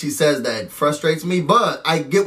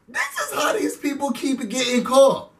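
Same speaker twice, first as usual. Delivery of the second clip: shouted mockery; 8 semitones higher than usual. Mocking a fake psychic.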